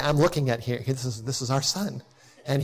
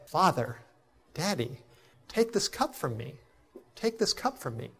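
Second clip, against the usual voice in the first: anguished plea; 8 dB quieter than usual. Retold or imitated. Son pleads with his father in Gethsemane, soft anguished prayer. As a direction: beg it quietly in anguish.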